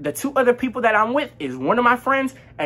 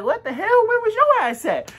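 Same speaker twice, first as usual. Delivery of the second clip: high-pitched mock outrage; incredulous demand; 12 semitones higher than usual. Friends doubting the one who says he was jumped, incredulous demand. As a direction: challenge him in squeaky disbelief. Acts out disbelieving challenge to friend.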